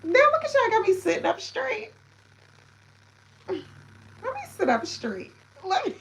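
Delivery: high, loud delighted exclaiming; excited squeal. Real, not acted. Streamer thrilled by viewers, high and loud. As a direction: gush at the chat, thrilled.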